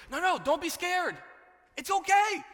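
high, loud, hammed-up angel voice; reassuring angel. Preacher as the angel soothing scared Zechariah, high and hammed-up. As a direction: pitch it way up to calm a frightened man. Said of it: "And the angel's like".

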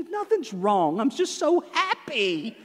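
husband mimicking his wife's happy tears; mock teary wife.

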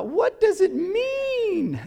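high, slow, awestruck imitation; drawn-out wonder of the weeping rainbow filmer; awed video guy. Pastor imitating the double rainbow man, high and awestruck.

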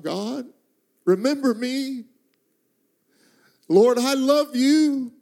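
high, stretched plea to God; crying out in prayer. Retold or imitated. Preacher recalls crying out to God on his knees, high pleading prayer. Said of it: "cry out".

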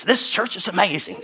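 lightly imitated pastor catchphrase; Pastor Mark. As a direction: quote a pastor's catchphrase with amusement. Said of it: "he always says".

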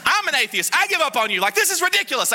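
very high-pitched exasperated mock rant; comic replay of being furious; retold outrage. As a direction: protest in squealing, hammed-up disbelief. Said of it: "I was so mad".

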